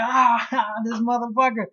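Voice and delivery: high laughing villain voice; imitating creepy laugh. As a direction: laugh through it like a gleeful villain. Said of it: "you have Obito's laugh".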